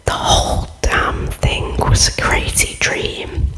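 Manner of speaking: plain reading voice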